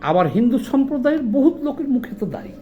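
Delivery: ordinary talk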